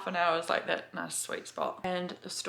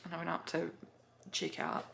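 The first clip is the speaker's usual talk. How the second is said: ordinary talk, nothing marked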